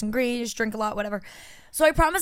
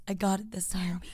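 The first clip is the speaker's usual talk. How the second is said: whispered aside; 5 semitones lower, 7 dB quieter than usual. Real, not acted. Self-correcting aside.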